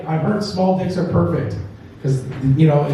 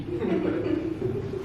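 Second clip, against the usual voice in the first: soft laugh; 10 semitones higher, 11 dB quieter than usual. Real, not acted. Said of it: "laughing at joke".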